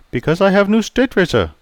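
put-on polite Chinese-accented barber greeting. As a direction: voice Pei the barber, courteous.

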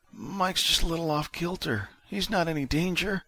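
high, feeble patient voice; Vic. A narrator's reading. Injured man defends Mike to June, weakly. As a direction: protest weakly, pitched high. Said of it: "Vic said weakly".

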